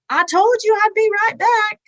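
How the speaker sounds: high, excited sing-out, imagined; imagined triumphant greeting. Acted. Greeting God after death, imagined high excited cry. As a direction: call it out high and delighted, as if arriving.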